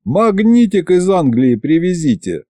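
high-pitched, mocking request; understated scoff; snorting. A narrator's reading. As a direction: toss it out with a touch of a snort. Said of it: "фыркнул я".

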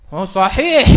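loud mock agreement; mimicked call-out. Preacher imitating someone in the audience, loud mock call. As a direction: call it out loudly, imitating a heckler.

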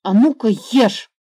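loud, stern order to eat; stern command. Acted. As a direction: bark it like a strict big brother. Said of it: "сказал грозно".

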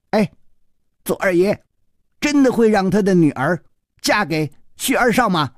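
put-on high boyish question; lightly voiced curious boy. Boy asking his friend about a marriage, curious high voice.

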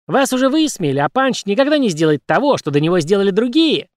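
whistling birdlike puppet; cartoon bird voice. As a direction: chirp it like a bird. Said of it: "свистящим птичьим голосом".

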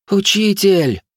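high shrill scream of teacher. Acted. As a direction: scream the word. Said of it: "крик Зоры".

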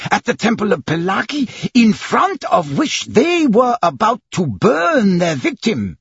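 high-pitched, heated outburst; impetuous. A narrator's reading. Servant accuses priests before the judge, impetuous. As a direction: blurt the accusation high, stressing as you go. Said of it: "exclaimed Passepartout impetuously".

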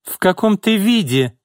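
high-pitched indignant outburst; indignant exclamation. A narrator's reading. Boss scolds her battered secretary, indignant. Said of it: "воскликнула Изольда".

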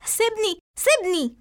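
acted angry protest, pitched high; angry cry. A narrator's reading. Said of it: "فتصيح إسراء غاضبة".

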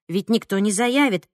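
ordinary delivery

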